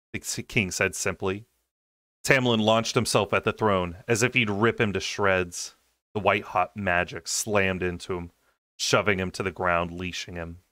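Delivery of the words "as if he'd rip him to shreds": ordinary delivery, nothing marked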